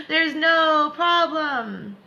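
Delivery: very slow, high and loud; slow high reassurance. Shown as a demonstration. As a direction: stretch out each word reassuringly. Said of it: "repeated plainly after".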